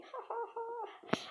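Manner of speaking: high, slow "ha ha ha"; high laugh. Real, not acted. Streamer reacting as a friend starts the game, high laugh.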